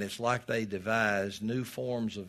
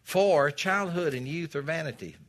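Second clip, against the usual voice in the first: scripture reading; 6 semitones higher, 6 dB louder than usual. Acted. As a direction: proclaim each phrase to the pews. Preacher quotes scripture.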